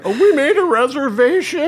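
very high, loud mock announcement; mock embarrassed. Retelling arriving at the empty restaurant, mock embarrassed. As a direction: squeak it out high, imitating yourself embarrassed. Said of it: "I was too embarrassed to say".

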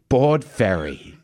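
slow, deadpan English satnav command; English woman GPS voice. Retold or imitated. Punchline of the GPS story, slow and deadpan English satnav. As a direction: announce it with prim calm. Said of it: "set on the English woman voice".

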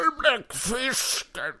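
a first, wrong try at the villain; wrong Octopus voice. Acted. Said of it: "Nej, så pratar han inte". (fel röst, högre och långsam)

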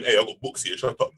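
lightly voiced tough roadman. Roadman listener shutting the hosts up, mock low voice. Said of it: "There's roadmen listening to this going".

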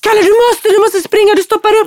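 high, loud urgent yelling; shouting. Retold or imitated. Mother yelling to stuck son. (mamman ropar högt åt Kalle att springa)

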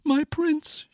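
high, unraised dismayed thought; small high voice of the heroine; Cindy thinking. A narrator's reading. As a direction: think aloud in a high girlish voice. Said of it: "she thought".

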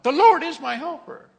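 high, loud triumphant cry; preacher proclaiming boldly; bold declaration. Shown as a demonstration. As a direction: shout it out with confidence. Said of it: "boldly say".

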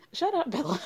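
giggling, mock scolding; laughing shut up. Real, not acted. Laughing tease at Bella.

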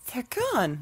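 gasp, then a high mock cry of shock. Podcaster acting Kara seeing Argo destroyed, mock shocked. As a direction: gasp and cry out as if horrified, hammed-up. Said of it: "and Kara's like".

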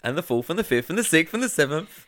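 laughing while listing. Real, not acted.

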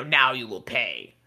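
low, slow villain's threat; menacing threat. Acted. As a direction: promise revenge, slow and low.

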